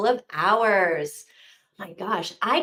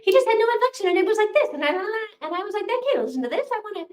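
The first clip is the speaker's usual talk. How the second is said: much higher, fast chipmunk squeak; 12 semitones higher, faster than usual. Retold or imitated. Mocking the sped-up audiobook narrator in a high chipmunk squeak. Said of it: "He sounded like a chipmunk".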